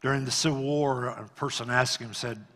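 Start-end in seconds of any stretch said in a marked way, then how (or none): none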